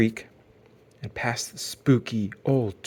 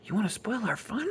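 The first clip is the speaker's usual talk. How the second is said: hushed threat; 10 semitones higher, 7 dB quieter than usual. A narrator's reading. Too Tall hushing Brother.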